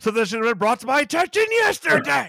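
high, loud prissy doctor, laughing through it; mock the doctor's officious complaint. Continuing the doctor parody, laughing.

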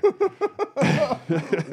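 big burst of laughter. Real, not acted. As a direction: crack up laughing. Laughing at printer story.

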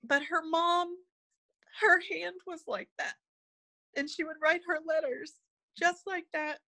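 fighting back tears as you tell it; choked up. Real, not acted. Telling the host about a mother's hands, choked up. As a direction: voice catching high, half whispered with tears. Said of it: "Sorry, I get choked up".

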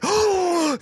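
high gasped oh; imitated jury-box gasp; mock shocked gasp. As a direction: gasp like a scandalised courtroom crowd. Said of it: "are shocked".

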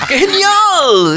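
very high, very slow, laughing dub voice; hammed-up dubbing, drawn out and high; mock dubbed exclamation. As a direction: stretch it out like a cheesy movie dub. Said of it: "hacen el doblaje de todas las películas".

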